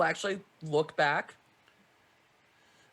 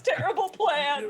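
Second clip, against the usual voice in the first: high, slow shout; 9 semitones higher, slower than usual. Real, not acted. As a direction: yell it out. Shouted quip.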